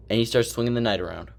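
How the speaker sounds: normal talking voice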